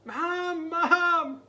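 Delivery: high, loud panicked cry for mom; scared scream. Acted. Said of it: "Jasper screamed".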